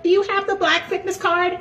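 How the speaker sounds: very high, prissy mock voice; gym Karen voice. Karen asking for his last name, in a very high prissy voice. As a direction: squeak it like a fussy desk clerk. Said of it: "I like".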